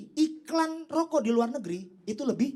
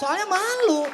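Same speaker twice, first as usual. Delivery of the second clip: higher and louder, blurted out; a loud, raised comic blurt; shouted punchline; 7 semitones higher, 6 dB louder, slower than usual. Acted.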